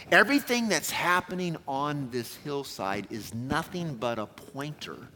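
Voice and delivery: usual speaking voice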